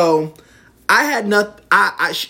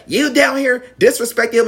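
high-pitched imitation of scolding; retold indignant scolding; mock accusation; 10 semitones higher, 3 dB louder than usual. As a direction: act out a mother laying blame, pitched up. Acted quarrel, mother accusing.

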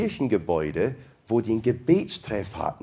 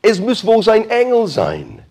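excited guess; 10 semitones higher, 8 dB louder than usual. Pretend. Christians conclude it's an angel.

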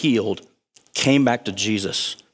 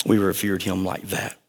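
usual speaking voice

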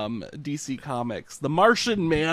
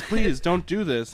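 mock pleading; 5 semitones higher, 5 dB louder, slower than usual. Repeated plea, drawn out laughing.

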